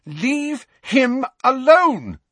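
loud, high, drawn-out shout. A narrator's reading. Lily defending Snape from his bullies, shouting. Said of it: "Lily shouted".

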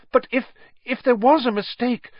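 put-on high, timid girl's plea; hesitant young girl; Hermione voice. A narrator's reading. Hermione pleading.

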